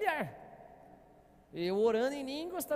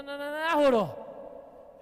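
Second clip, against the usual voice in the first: triumphant release; 3 semitones higher, 8 dB louder, much slower than usual. Real, not acted. Climax of the prayer story.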